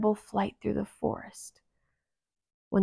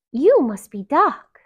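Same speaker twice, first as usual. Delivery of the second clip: sweet princess voice; 6 semitones higher, 10 dB louder than usual. Acted.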